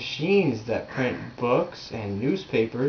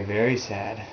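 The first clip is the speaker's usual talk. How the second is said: usual speaking voice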